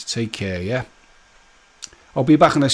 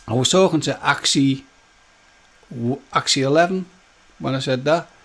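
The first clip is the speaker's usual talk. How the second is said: ordinary delivery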